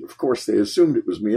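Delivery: ordinary talk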